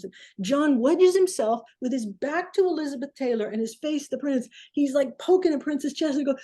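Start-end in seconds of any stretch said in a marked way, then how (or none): none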